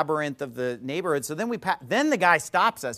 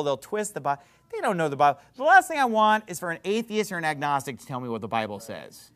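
ordinary talk, nothing marked

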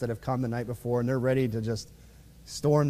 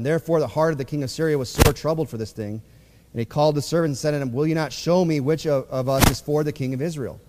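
louder formal reading of King James verses; scripture reading; 4 dB louder than usual. Acted. Preacher reads the verse to his church, in a formal reading voice. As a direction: read the old scripture out to the congregation, a bit louder.

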